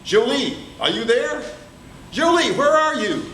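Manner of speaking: high calling voice through door. Acted. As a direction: call her name up high. Pierre calls at door.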